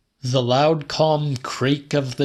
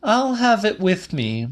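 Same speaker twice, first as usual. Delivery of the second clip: higher-pitched Celia; Celia's voice; 9 semitones higher than usual. A narrator's reading. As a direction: keep her voice up high. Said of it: "she said".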